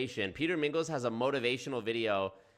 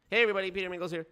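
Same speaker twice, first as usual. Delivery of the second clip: hammed-up higher cheery intro; mock Peter Mingles; 7 semitones higher, 3 dB louder, much faster than usual. Streamer mocking the video host, hammed-up cheery greeting. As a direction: lampoon his greeting, pitched up.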